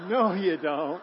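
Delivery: loud, laughing no you don't; laughing refusal. Retold or imitated. Preacher to couple, a laughing refusal. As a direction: laugh it off firmly. Laughing retort to dinner offer.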